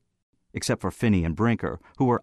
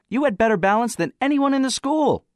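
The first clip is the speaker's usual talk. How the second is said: very high, loud challenger; voice from platform; 5 dB louder than usual. Acted. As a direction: call it out high and insistent. Anonymous questioner on the platform.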